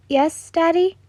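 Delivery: put-on high, sweet child voice; a small girl answering her father; little girl voice.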